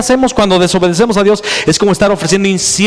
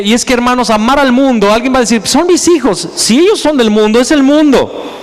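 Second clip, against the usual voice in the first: preaching at full force; 6 semitones higher than usual. Acted. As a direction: drive it home to the congregation, voice raised.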